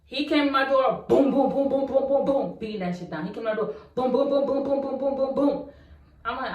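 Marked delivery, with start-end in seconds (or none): 1.1-2.2 loud, high booming knocks; mock door pounding. Acting out the ex banging on her door. 4.1-5.7 loud, high booming knocks; mock door pounding. Acting out the ex banging on her door.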